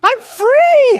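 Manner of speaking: ecstatic high squeal, very loud; helium voice. Real, not acted. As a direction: shriek with pure joy. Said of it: "Pardon my helium voice".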